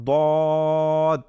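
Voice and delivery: "দদ" with a long, steady rounded vowel; model pronunciation. Shown as a demonstration. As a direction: hold the middle of "দদ" slowly and evenly. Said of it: "উচ্চারণ করার সময় করব".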